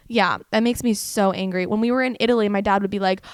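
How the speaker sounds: ordinary delivery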